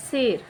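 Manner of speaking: शेर with a relaxed drawn e; relaxed long e. Shown as a demonstration. Teacher to pupils, relaxed drawn-out शेर. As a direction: ease into the e of शेर. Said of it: "ये relax होकर के हमने बोला".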